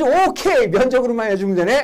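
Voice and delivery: loud, high, cocky student voice. Retold or imitated. Teacher mimicking a careless student rushing the answer. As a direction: imitate the overconfident student.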